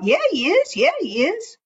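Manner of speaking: high little frog voice; a small frog piping up high. Acted. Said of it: "Little Frog called out".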